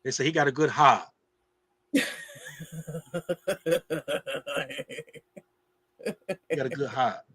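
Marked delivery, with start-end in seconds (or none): 0.2-1.1 put-on Boston accent, "heart" said as "haht"; imitated New England "haht" for "heart"; Boston dropped R. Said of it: "they mess up their R's". 1.9-7.2 imitated Boston accent, "heart" as "haht"; New England "haht" for "heart"; Boston dropped R. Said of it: "they mess up their R's".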